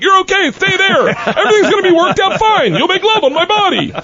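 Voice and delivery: high, laughing mock Russian reassurance; a hammed-up Russian promising it's fine; Igor's Russian voice. Igor reassuring the narrator, mock high Russian.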